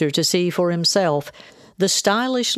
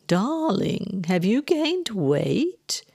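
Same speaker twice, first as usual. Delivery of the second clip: slow, high-pitched sweet needling; belittling mother; 5 semitones higher, much slower than usual. A narrator's reading. Mother looking her daughter up and down, sweetly belittling. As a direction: coo it, stretching the words.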